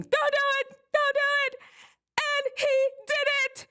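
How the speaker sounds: high-pitched, frantic scream; retold screaming. Sister screaming as brother jumps off the cliff, panicked scream. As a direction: shriek it out in mock panic. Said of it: "I was screaming".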